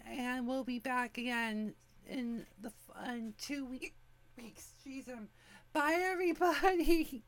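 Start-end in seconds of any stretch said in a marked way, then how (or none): 5.7-7.2 cheerful goodbye. Real, not acted. Cheerful sign-off to listeners.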